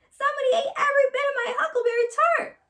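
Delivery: very high, fast, loud cub squeal; squealing little bear. A narrator's reading. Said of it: "squealed Little Honey in his little bear voice".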